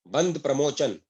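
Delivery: ordinary delivery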